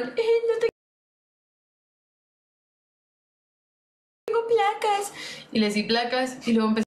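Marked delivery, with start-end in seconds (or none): 0.0-5.1 higher, mocking voice of a gamer begging for plates; mocking whiny gamer. A light mock of the trolls asking her for armor plates. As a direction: ape them in a high taunting whine.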